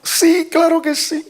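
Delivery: very high-pitched, imitated crying plea; mock tearful. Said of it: "Y yo llorando, dije". (imitando un llanto suplicante, con voz muy aguda)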